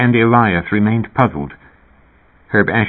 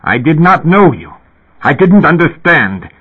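high, louder, upset protest; storyteller's raised voice of dismay; violent dismay; 8 semitones higher, 5 dB louder than usual. A narrator's reading. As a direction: protest in shocked indignation. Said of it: "With violence and dismay he regarded her".

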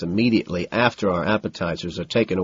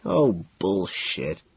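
slow, disgusted scoff; cringing; 3 semitones lower, 5 dB quieter, much slower than usual. A narrator's reading. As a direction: drag it out in disbelief.

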